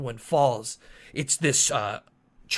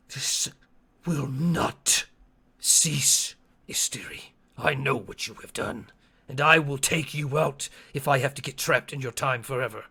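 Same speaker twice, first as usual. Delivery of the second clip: lightly voiced defiant Chotuku, halting then firm; measured, deliberate vow with weighty pauses; defiant creature in a story. Game master as Chotuku defying Asteri, lightly voiced, defiant.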